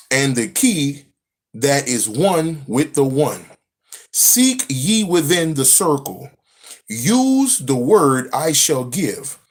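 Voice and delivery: put-on slow, grave reading of an old text; solemn scripture reading. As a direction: intone it like sacred scripture. Reads ancient scripture aloud.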